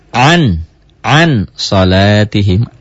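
AN pronounced with a distinct N; clear N before veiling. Shown as a demonstration. Teacher giving pupils the written example, clear AN. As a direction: say AN slowly with the tongue landing on N. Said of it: "Bunyi N-nya tidak an lagi".

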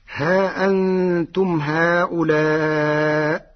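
slow melodic chant; Quranic recitation. Shown as a demonstration. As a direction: chant ها أنتم هؤلاء slowly. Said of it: "Quran recitation". (ترتيل بطيء)

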